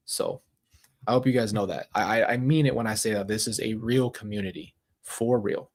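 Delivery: ordinary delivery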